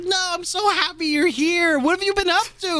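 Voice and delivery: high falsetto chatter; sweet, high-voiced catching up; mock gushing. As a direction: gush like a starstruck fan. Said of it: "You're like".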